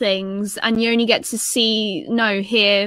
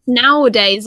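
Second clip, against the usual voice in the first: normal talking voice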